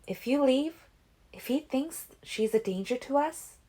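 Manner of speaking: worried. A narrator's reading. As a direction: fret over the danger. Alice warns Edward.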